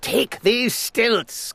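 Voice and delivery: slow, high imperious order; commanding. A narrator's reading. Lord Droon at the window ordering the page boy, commanding. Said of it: "commanded Lord Droon".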